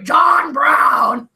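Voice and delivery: loud, booming documentary-style proclamation; John Brown. Shown as a demonstration. Demonstrating a documentary voice for co-hosts, loud mock-dramatic proclamation. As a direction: bellow the name like a dramatic documentary voice. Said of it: "I'll do it in the John Brown voice".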